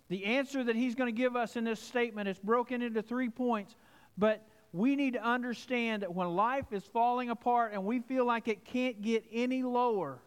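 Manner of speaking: earnest sermon delivery; preaching. Acted. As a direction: press the point to the congregation. Preaching the main point.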